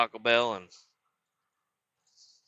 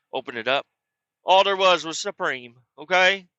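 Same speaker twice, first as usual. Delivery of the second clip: ordinary talk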